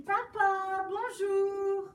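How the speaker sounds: high, loud, sing-song greeting; cheerful princess. Acted. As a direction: sing it like a happy little girl. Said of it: "la fille du roi arrive et dit".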